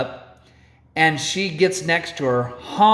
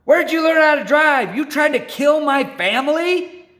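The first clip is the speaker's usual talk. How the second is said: high, loud furious yell out the window; yelling mom; 12 semitones higher, 4 dB louder than usual. Retold or imitated. Angry mom yelling at the chalupa eater from her car, high and furious. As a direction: scream it at the other driver. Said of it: "She yells out".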